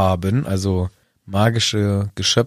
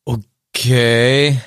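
Okay stretched long and pushed louder; a slow, weighty Okay at the politics question; long loaded okay; drawn-out okay; 5 dB louder than usual. Acted. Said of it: "die Betonung macht's".

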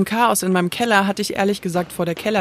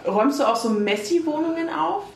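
talking through a mouthful of pizza; words round a bite of food; chewing while talking; 6 semitones higher than usual. Real, not acted. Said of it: "also guten Appetit übrigens".

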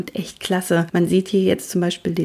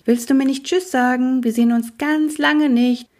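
put-on higher, pleading young voice; calling out; 8 semitones higher than usual. A narrator's reading. Luzifer calls to sulking Cornibus for a goodbye, high. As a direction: call coaxingly to someone hiding. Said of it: "rufe ich".

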